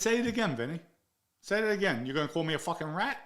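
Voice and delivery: high, indignant dare; retold angry challenge. Alone with Vinny, daring him to repeat the insult, angrily.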